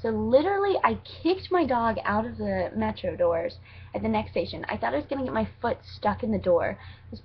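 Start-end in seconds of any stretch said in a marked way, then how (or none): none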